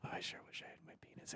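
breathy whisper into the mic. Real, not acted. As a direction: whisper it, barely voiced. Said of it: "you're wearing headphones".

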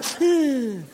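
drawn-out waking inhale and sigh; waking breath. Retold or imitated. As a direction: act out waking from a sudden doze. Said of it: "she'd wake up and go".

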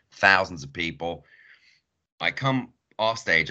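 ordinary talk

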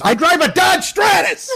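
slow, high-pitched yell; high strained comic shout; outraged shout. Acted. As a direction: holler it as a mock outburst. Shouted Will Ferrell movie quote.

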